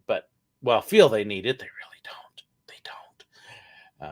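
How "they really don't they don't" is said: hushed aside; whispering. Real, not acted. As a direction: whisper it under your breath. Aside about drinking in parks.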